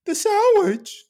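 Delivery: very slow hammed-up Bane, laughing; mock Bane voice. Bane at the drive-thru, very slow. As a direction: order a sandwich as Bane, drawn out. Ordering fast food as Bane.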